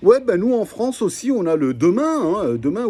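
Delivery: high, lilting French accent, lightly hammed. French friend Jean answering Jimmy's call, hammed French accent. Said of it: "Let me call him".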